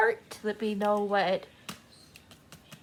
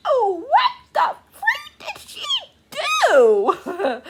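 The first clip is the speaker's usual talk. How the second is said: much higher, loud laughing half-shout; shocked exclamation; 6 dB louder, much slower than usual. Retold or imitated. Vlogger reliving a shocking book scene, high laughing shout. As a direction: cry out in amazed disbelief. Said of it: "I was like".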